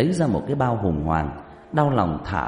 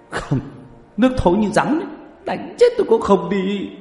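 high, loud, stubborn protest; hammed-up, high-pitched shouted refusal; vehement refusal; 11 semitones higher, 5 dB louder than usual. A narrator's reading. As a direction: cry out a flat-out refusal. Refuses again, loudly.

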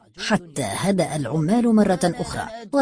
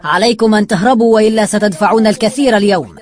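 raised, forceful warning; loud warning; 4 semitones higher, 7 dB louder than usual. A narrator's reading. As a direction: call out a threat at higher pitch. Warns the gang to flee.

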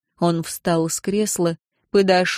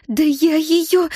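slow furious hiss, higher; hissed threat; 9 semitones higher, 3 dB louder, slower than usual. A narrator's reading. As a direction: spit the threat through clenched teeth. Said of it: "процедила она, сжимая кулаки".

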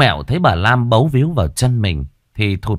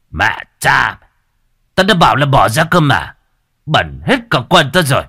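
loud, raised, furious scolding; angry cursing; 4 semitones higher, 5 dB louder than usual. Acted. Village chief kicks away the clinging mother, angry loud cursing. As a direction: curse and drive her off.

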